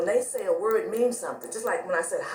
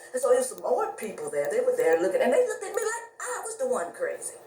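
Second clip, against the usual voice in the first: ordinary talk, nothing marked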